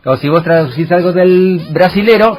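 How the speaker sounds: ordinary delivery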